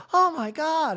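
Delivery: very high mock shock; Kyle. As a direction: gasp it out as an exaggerated impression. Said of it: "And Kyle's like".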